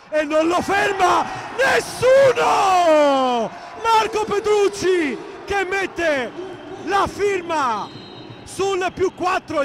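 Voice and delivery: slow, drawn-out excited shout; roaring the decisive play; breathless italian goal commentary. Real, not acted. Commentator erupts at decisive basket.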